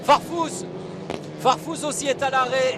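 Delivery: excited commentator. Real, not acted. Calling stopped cars live.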